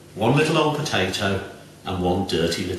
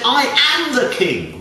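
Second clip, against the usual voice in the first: indignant king; 13 semitones higher, 3 dB louder than usual. A narrator's reading. King declares himself, high.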